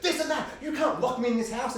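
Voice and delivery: high, loud mock shout of a trapped mother; angry mum. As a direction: yell it like a furious mum locked in. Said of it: "the mum's like".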